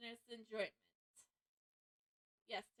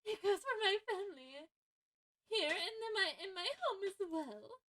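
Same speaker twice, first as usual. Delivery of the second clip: sweet high warden voice; 8 semitones higher than usual. Acted.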